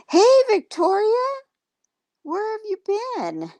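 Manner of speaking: high, loud, sing-song hello called out; excited greeting. Real, not acted. Calling out greeting to viewer.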